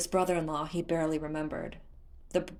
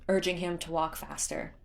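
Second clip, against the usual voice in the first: plain reading voice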